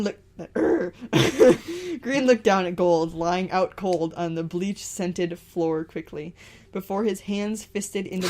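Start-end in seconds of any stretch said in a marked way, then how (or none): none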